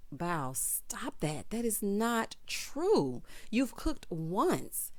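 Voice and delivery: very slow, measured mock telling-off; drawn-out reproach. Husband correcting his wife's claim, slow reproach.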